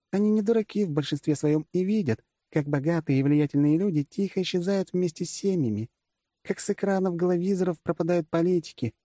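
high-pitched commander explaining at length; female generalissimus, earnest. Acted. Gadya explains the politics. (высокий женский голос, объясняет серьёзно)

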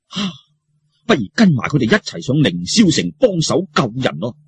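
ordinary delivery, nothing marked

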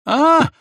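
sudden high loud shriek; shrill shriek. A narrator's reading. Милана shrieks as he grabs her, high loud squeal. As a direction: squeal sharply in shock. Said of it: "завизжала Милана".

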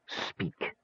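breathy whispered order; menacing whisper; whispered command. Acted. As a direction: hiss the order close. Mage commands creature.

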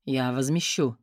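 low, quiet curt man's promise. Acted. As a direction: tall man answers low and brief. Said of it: "Слышу сверху низкий голос".

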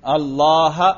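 "اللہ" ending in a short, clear -ha; نصب کا تلفظ. Shown as a demonstration. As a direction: say "اللہ" as Allaaha, with the final a sounded. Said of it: "اس کے نصب پر تلفظ کرو". ("اللہ" کے آخر میں زبر، یعنی اللّاہَ)

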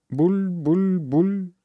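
slow, high bubbling gurgle; gurgling underwater. A narrator's reading. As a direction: gurgle like a mouth full of water. Drowning boy gurgles in river.

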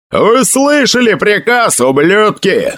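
high, rough sergeant-style yell. A narrator's reading. Emil backs up the captain's order, yelling. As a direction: bawl the troops into moving. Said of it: "завопил Эмиль".

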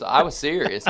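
usual speaking voice

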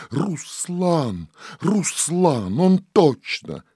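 high, rising excitement; breathless joy, pitched high; excited recognition. A narrator's reading. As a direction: cry out the name of a long-lost love. Princess thrilled to see her husband.

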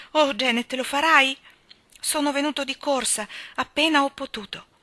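urgent pleading. A narrator's reading. Man pleads with her to marry him.

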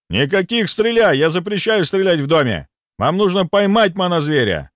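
high, forceful bellowed orders; policeman's roar. Acted. Policeman forbidding shooting in the house, roaring. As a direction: roar out a furious ban. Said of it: "взревел полицейский".

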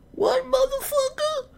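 very high, slow mock-aggressive voice; caricatured hostile bar patron, drawn out high; hostile drinker. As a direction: imitate a guy ready to punch you. Drinker's hostile reply retold.

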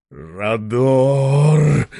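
blissful exclamation. A narrator's reading. Chairman crying out in bliss from the shelf. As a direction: savour the heat aloud. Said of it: "сладостный возглас".

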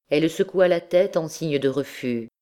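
plain reading voice

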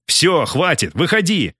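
high, urgent call; narrator's contained shout; shouted order. A narrator's reading. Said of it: "прокричал Артур".

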